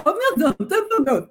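high, lisping mock Spanish royalty voice; lisping king. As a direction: play a pompous king with a lisp. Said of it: "talk like a king who had a speech impediment".

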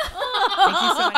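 high, slow, laughing thanks; laughing gush. Retold or imitated. Doctor thanking the boyfriend, high laughing gush.

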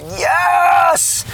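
high, loud, long-held yell; shout of praise. Retold or imitated. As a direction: lift up a triumphant shout. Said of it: "I just lift up this shout".

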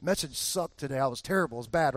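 low, soft dejected mutter; mild mock despair; moping self-pity. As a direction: sulk like you preached badly.

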